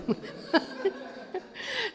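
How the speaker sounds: high, breathy burst of laughter; laughing. Real, not acted. Daughter amused by her remark to mother, laughing. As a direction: laugh at your own remark. Laughs after own joking reply.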